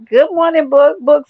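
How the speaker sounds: loud, high welcome; loud greeting. Real, not acted. Streamer greets chat member Book, loud and high. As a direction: call out good morning to Book.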